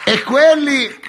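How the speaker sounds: racconto gridato, acuto e forte; urlo rievocato. Retold or imitated. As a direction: declama la visione urlando. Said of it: "Allora a un certo punto urlai". (esaltazione comica a voce alta)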